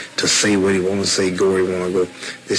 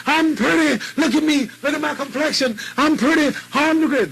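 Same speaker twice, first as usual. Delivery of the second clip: boastful shout. Retold or imitated. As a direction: crow it like a champion. Retold boast.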